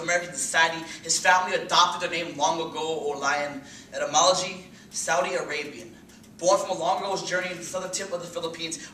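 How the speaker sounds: loud, high, urgent slam-poetry delivery; impassioned, rolling poetry recital; spoken-word poem. Acted. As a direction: drive the verse forward with hard-hit stresses. Recites a poem, building intensity.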